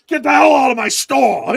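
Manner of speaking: loud, high mobster yell; mobster shout. Retold or imitated. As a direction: yell like a Sopranos shopkeeper. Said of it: "that Sopranos meme".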